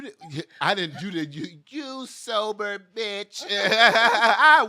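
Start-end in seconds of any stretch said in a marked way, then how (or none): 1.7-4.6 slow, high taunt breaking into laughter; playful loud jeer, words stretched out; mock outrage. Mock insult over bullied for sobriety.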